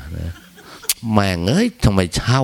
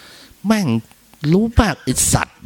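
high, sharp mock insult; playful swearing at a friend; mock cursing; 11 semitones higher, slower than usual. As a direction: spit the curse out in a high voice. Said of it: "ด่ากัน".

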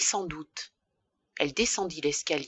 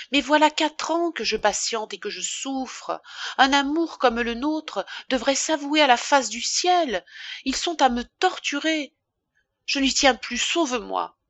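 tearful pleading; 6 semitones higher than usual. A narrator's reading. Emma begs Rodolphe, in tears.

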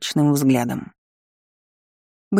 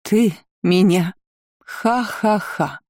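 slow, staged cackle, much higher at the end; fake mocking laugh; 4 semitones higher, much slower than usual. Vampire mocks the girl's threat with a fake laugh. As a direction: laugh it off with theatrical scorn. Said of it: "наигранно расхохоталась".

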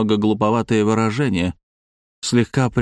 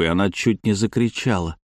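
usual speaking voice

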